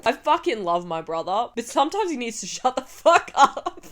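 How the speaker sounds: laughing through the words; giggling while scolding fondly. Real, not acted. Laughing about her brother.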